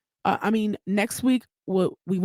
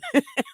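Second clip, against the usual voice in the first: laugh; 8 semitones higher than usual. Real, not acted. Laughing at own joke.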